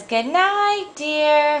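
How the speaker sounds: high, sweetly acted wifely goodnight; zookeeper's wife. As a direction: say it high, to a husband. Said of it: "Missus Zookeeper says".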